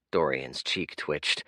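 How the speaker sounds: usual speaking voice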